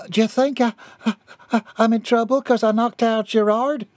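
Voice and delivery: high, hesitant, stammering start; worried wife. A narrator's reading. Bobby Sue asking her husband about trouble, worried and halting. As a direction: stumble over the first words nervously.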